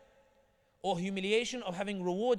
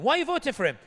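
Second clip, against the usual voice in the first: incredulous question; 7 semitones higher, 10 dB louder, faster than usual. Retold or imitated. Quoted questioner.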